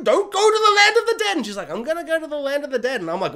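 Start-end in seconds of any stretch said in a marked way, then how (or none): 0.0-1.4 high, loud mock plea; hammed-up urgent cry; alarmed warning. Bear's warning acted out. 1.7-3.0 quick, higher retort in a mock girl's voice; a hint of a determined girl; lightly voiced girl. Girl's defiant reply acted out.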